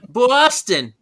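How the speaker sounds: loud, high Boston accent. Shown as a demonstration. Friend tries it on request, loud Boston accent. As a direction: shout it like a Boston local. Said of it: "Try to say it in a Boston accent".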